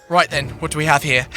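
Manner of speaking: a hint of a British chef; Gordon impression. Gordon Ramsay voiced in dream.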